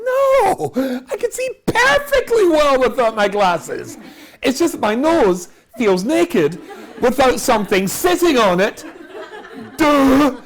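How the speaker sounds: loud, high mock-exasperated retort; sarcastic outburst. Passenger imagines snapping back at flight attendant, loud sarcasm. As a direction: blurt it out indignantly, high and very loud.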